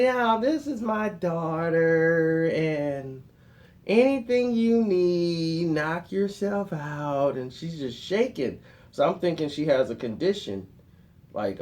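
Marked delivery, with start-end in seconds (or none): 0.0-7.4 lightly voiced girlfriend, slow; slow, shaky welcome; mild put-on daughter voice; a touch of a daughter. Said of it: "She's like".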